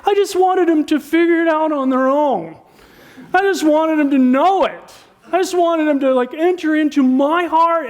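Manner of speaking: high, pleading mock complaint; high-pitched mock whine of a hurt spouse; whiny spouse. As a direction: imitate a wistful spouse wanting to be understood. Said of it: "you know what I hear spouses say".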